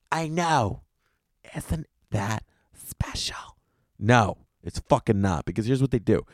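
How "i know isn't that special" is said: hushed mock-sweet whisper, slowed down; whispered sarcasm, drawn out slowly; sarcastic whisper. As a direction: breathe it out like a sarcastic church lady. Sarcastic aside on free delivery.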